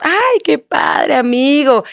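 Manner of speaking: high, drawn-out sugary greeting; mock fake cheer. Wife mocking husband's friendliness with others, sugary. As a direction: parody his fake sweetness in public.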